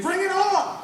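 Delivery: high drawn-out "bring it on" cry; mock battle shout. Comic acts out one dude daring invaders, high holler. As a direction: holler it up high, dragging the last word. Taunting the fighter pilot to attack.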